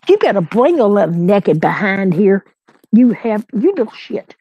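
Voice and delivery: exasperated pet scolding; scolding the puppy. Real, not acted. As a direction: call the naughty dog to come here. Scolding her misbehaving puppy.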